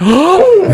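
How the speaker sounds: mock horrified gasp, high and sharp; shocked gasp. Lady exec hearing about the dog, mock shocked gasp. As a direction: imitate a woman's sudden shocked gasp. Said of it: "she just went".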